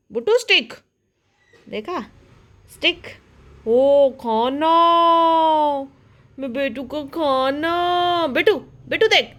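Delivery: high, loud cooing pet-talk. Real, not acted. Baby-talking to her dog.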